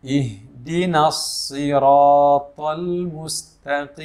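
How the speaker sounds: slow, drawn-out tajwid chant; slow melodic Quran recitation. Shown as a demonstration. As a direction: recite it slowly like tilawah. Recites verse.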